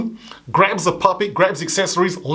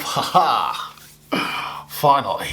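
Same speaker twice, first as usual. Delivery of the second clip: gleeful low chuckle; 4 semitones lower, much slower than usual. Acted. He laughs to himself.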